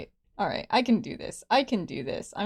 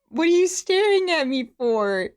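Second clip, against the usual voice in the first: high, whiny mock scolding; self-conscious mock outrage; playful indignation; 7 semitones higher, 3 dB louder than usual. Real, not acted. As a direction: call out the onlooker in a high, affronted voice. Playfully scolding watching cat.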